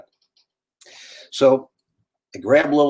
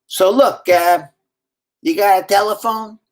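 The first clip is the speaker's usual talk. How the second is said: higher, louder New Orleans street voice; raspy New Orleans accent; 6 semitones higher, 4 dB louder than usual. Retold or imitated. Stranger in the house asking for a phone in a New Orleans accent. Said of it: "in a very raspy New Orleans accent".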